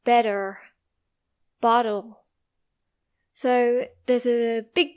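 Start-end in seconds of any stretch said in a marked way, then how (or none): 0.0-0.6 BETTER said like bedder, T flapped; American flapped T. Shown as a demonstration. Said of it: "They would say". 1.6-2.2 BOTTLE said like boddle, T flapped; American flapped T. Shown as a demonstration. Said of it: "They would say".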